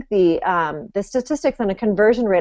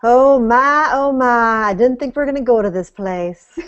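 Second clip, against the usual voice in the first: a light, hammed-up Southern drawl on the exclamations; Southern accent; 4 semitones higher, 4 dB louder than usual. Host asks guest for her Southern accent, guest answers in it. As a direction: play the flustered Southern belle, lightly. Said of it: "you know I wanna hear your Southern accent".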